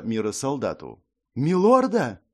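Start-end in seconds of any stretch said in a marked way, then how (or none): 1.4-2.2 high, loud horrified question. Acted. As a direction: recoil at the order in horror. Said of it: "ужаснулся солдат".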